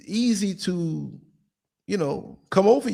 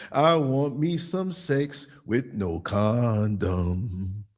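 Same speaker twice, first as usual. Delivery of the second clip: reckless man voice; 6 semitones lower, 3 dB quieter, much slower than usual. Retold or imitated.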